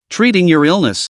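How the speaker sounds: plain reading voice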